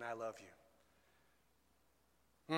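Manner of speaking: soft, low voice, lightly hushed. Acted. Preacher repeating God's message to the congregation, softly voiced. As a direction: say it quietly, just above a whisper. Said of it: "he's whispering to us".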